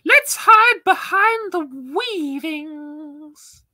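very high, loud drawn-out squeal; put-on squeaky player. Player declares their move in the game, loud squeaky voice. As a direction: call it out high and slow.

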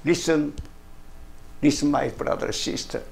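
ordinary talk

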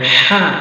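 "হা" squeezed from mid-throat; pressed Arabic ha. Shown as a demonstration. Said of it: "এভাবে চাপ দিয়ে".

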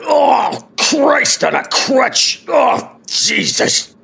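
high, slow pained wail; drawn-out wail of a wounded vampire; Max in agony. Acted. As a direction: cry out to the heavens in pain. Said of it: "the first thing you hear from Max is".

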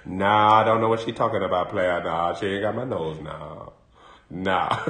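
low mumbling denial as Willie; Willie denying. Retold or imitated. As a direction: play the guilty man who brushes it off low. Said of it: "But Willie".

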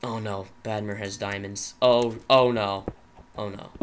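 usual speaking voice

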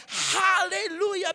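high, loud, drawn-out praise cry; exultant shout. Retold or imitated. Preacher praising God alone in his car, shouting joyfully. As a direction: stretch it out as a jubilant outburst.

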